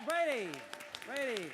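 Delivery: loud cheering call, high and loud; shouted name from the crowd. Real, not acted. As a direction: hype up the next speaker by name. Calling out the next speaker.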